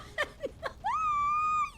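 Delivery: very high, breathless squeal of laughter. Real, not acted. Player cracking up at a game mishap, squealing laugh.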